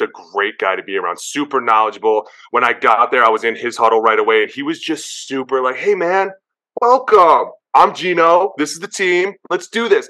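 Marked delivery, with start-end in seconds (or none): 5.8-10.1 friendly greeting. Retold or imitated. Geno welcoming him to huddle.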